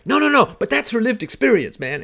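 loud, high, hammed-up objection; mock annoyance. Debater cuts off a factual correction, mock annoyance. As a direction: imitate a flustered debater cutting in. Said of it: "Alex just getting annoyed".

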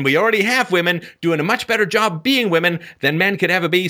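normal talking voice

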